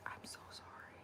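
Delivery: tiny, barely-there whisper; whispered apology. Retold or imitated. Customer apologising to the nice owner, shamefaced whisper.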